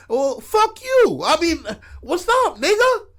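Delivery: high, loud acted comeback; retold angry challenge. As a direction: square up for a mock fight, pitch way up. Retold quarrel with old man.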